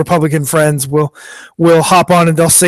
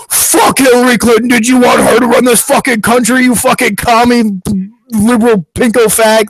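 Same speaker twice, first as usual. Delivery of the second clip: imitated angry tirade, pitched up; ranting commenter; 4 semitones higher than usual. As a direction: spew it as an irate online troll in one rush. Acting out an angry online commenter.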